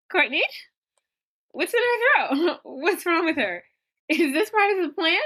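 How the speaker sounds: put-on high, panicked girls asking what happened; panicked friends. Friends panicking at the trunk, high and scared. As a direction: squeal it out like scared teen girls. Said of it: "Julie and Marcy are like".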